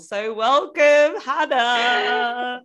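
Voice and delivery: drawn-out, high, loud welcome; excited welcome. Acted. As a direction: announce the guest with a flourish. Host welcoming guest.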